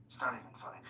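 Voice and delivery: whispered, not funny; whispered rebuke. Real, not acted. Repeating it to himself in a whisper. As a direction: breathe it out, disapproving.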